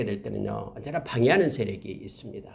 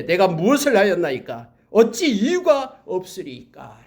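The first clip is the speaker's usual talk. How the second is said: slow, emphatic scripture retort, pitched up; retold protest; 5 semitones higher, 5 dB louder than usual. David answers his brother's scolding, retold protest. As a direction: voice David's protest, rising higher.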